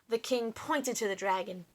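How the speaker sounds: plain reading voice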